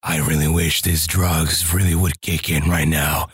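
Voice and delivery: low, gruff Max Payne-style voice; gravelly game noir voice. Retold or imitated. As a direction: growl it like a noir game hero. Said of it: "they talk like this".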